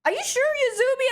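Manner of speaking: high, fast disbelieving question; shocked uncle. Retold or imitated. Uncle can't believe it is his niece, fast high shocked question. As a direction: fire it off in mock disbelief. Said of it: "he was so shocked".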